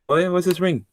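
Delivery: challenging. Acted. Ex challenged about the ring.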